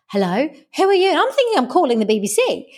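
mock bewilderment, high and hurried; puzzled caller. Retold confused phone call, as caller.

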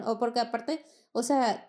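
normal talking voice

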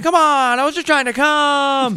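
high squeaky panicked plea; hammed-up high begging voice; mock pleading. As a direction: whine it like a guy caught mid-act. Caught in a raid, pleading.

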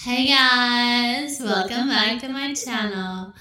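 put-on American-accented vlogger greeting. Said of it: "Now let's try an American accent".

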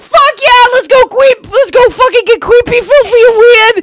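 very high, loud mock hype; hyped-up cheering. Imitating the guys heading out to creep, hyped-up cheering. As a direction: whoop it up like the rowdy guys. Friends' rowdy cheer acted out.

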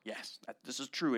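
normal talking voice

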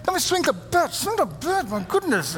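high, exasperated pleading; will the boy to swing, half-laughing at yourself; frustrated parent. Retold or imitated. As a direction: mock-desperate sports dad groan. Frustrated dad at the game.

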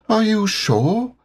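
high, slow, worried little voice; anxious Piglet. Acted. Piglet anxiously doubting Pooh, high and worried. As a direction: fret about it like a nervous piglet. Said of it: "asked Piglet anxiously".

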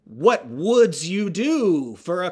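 drawn-out horror villain pun; Freddy-style Slender Man. Pretend. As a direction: ham up a slasher taunting. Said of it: "what if Slender Man were like Freddy".

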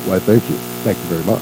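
low, drawling rock-and-roll King. Acted. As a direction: drop low for the King's famous thanks. Priest gives in, answers as [name].

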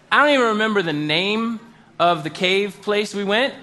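normal talking voice

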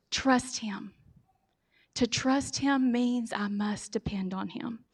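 soft whisper, drawn out; preacher whispering a plea to trust God; hushed whisper. Real, not acted. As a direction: lean in and whisper it like a secret. Intimate appeal to the congregation.

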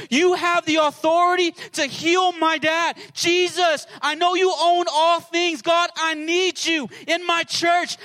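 loud, high, urgent cry to Jesus; bold, audacious prayer. Acted. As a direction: plead at full voice with fearless faith. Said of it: "gotta have an audacious faith".

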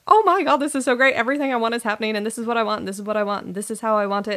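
high, loud, rushing burst of excitement; squealing with excitement, fast and high; freaking excited. Retold or imitated. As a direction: gush like everything is finally happening. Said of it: "got freaking excited".

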